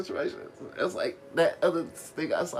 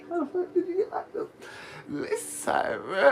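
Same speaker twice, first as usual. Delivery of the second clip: high, incredulous disbelief at the friends; exasperated retold question. Asking his friends how they got locked up, in incredulous disbelief. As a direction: voice jumps up in disbelief. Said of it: "it's like".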